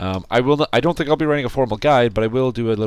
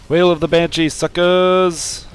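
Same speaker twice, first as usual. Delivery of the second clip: gleeful battle cry; 8 semitones higher, 6 dB louder, much slower than usual. Real, not acted. Casting a big spell gleefully.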